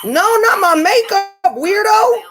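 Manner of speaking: startled yell. Real, not acted. Yelling at cat.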